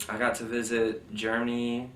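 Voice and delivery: usual speaking voice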